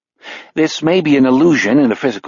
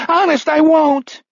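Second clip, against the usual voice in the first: much higher, terrified pleading; gibbering. A narrator's reading. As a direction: beg in a panicked babble. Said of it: "he gibbers".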